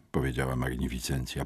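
plain reading voice

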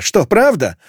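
high, gleeful questioning; delighted, grinning subordinate. A narrator's reading. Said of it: "осклабилась та".

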